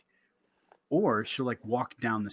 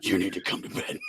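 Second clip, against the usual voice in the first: lower, stern wife voice; imitating wife; 7 semitones lower than usual. Wife on the stairs again ordering him to bed. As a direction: repeat her order, low and firm. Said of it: "seeing her come down going".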